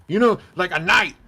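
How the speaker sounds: high, loud and emphatic; insistent retold explanation. Buddy explaining himself to a confused friend, loud and insistent.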